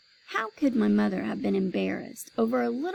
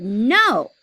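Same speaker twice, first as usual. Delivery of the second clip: loud refusal; 9 dB louder than usual. A narrator's reading. Child refusing new shoes.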